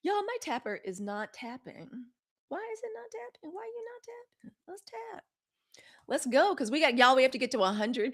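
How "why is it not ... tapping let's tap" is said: tiny high, hushed voice; plaintive coaxing. Real, not acted. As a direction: plead very softly in a high squeak. Coaxing the faulty tapper.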